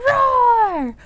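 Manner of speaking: high, loud pretend roar; dragon roar. Librarian playing dragon for children, roaring loudly. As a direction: roar the word out long like a dragon. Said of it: "so roar really loud".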